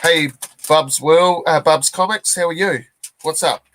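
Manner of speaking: ordinary talk, nothing marked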